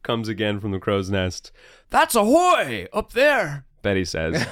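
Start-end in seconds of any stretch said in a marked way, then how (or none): 1.9-3.6 high amused sailor woman; light high character voice; Betty's voice. Acted.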